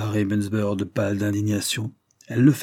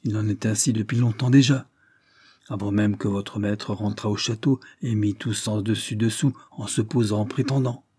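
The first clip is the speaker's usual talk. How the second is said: ordinary delivery, nothing marked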